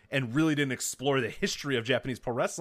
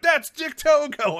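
smug; 9 dB louder, much slower than usual. Retold or imitated. Friends quoting him, mocking.

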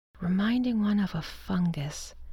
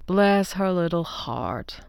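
slow, fond husband voice; a lightly voiced doting husband, drawn out; husband John, fond; 3 dB louder, slower than usual. A narrator's reading. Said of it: "he said with a big hug".

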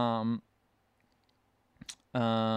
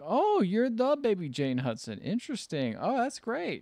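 feigned interest; 7 semitones higher, slower than usual. Retold or imitated. Acting his feigned interest in Jane.

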